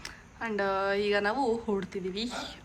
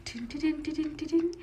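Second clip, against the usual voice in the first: put-on higher, playful tinkling sound for the baby; anklet jingle; 5 semitones higher, 3 dB quieter than usual. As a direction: jingle like little bells. Imitating anklet bells for baby.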